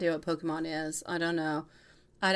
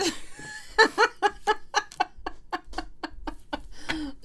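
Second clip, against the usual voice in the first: long unvoiced, wheezing laugh; breathless laugh; 13 semitones higher than usual. Real, not acted. Reader still laughing at the polite insult, wheezing laugh. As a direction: laugh silently, air only, for a long stretch.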